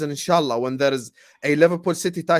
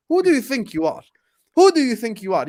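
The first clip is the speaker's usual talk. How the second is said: high, loud furious yell; shouting at Pep in anger; angry shout; 8 semitones higher, 6 dB louder than usual. Real, not acted. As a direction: yell it at him, outraged. Ranting at Pep.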